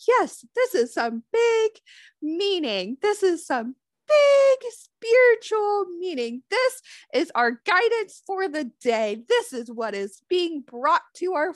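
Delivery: high, loud, hammed-up mystic voice; mock mystic. Negative mind mocking tarot readers, high hammed-up mystic voice. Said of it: "and just say, like".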